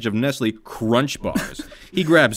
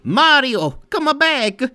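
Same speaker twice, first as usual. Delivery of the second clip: very high, louder call; Luigi calling; 5 dB louder, much slower than usual. Acted. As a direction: shout after him as Luigi. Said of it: "Luigi chases Mario".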